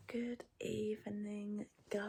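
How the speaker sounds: hushed late-night whisper. Real, not acted. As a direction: whisper so nobody wakes. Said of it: "I have to whisper 'cause".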